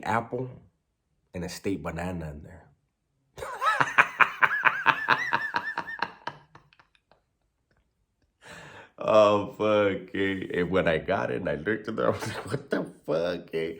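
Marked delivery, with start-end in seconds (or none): 3.4-6.6 high, loud burst of laughter; real laugh. Real, not acted. Breaks up at the punchline. 9.0-13.8 amused. Real, not acted. Recovering from laughing at punchline.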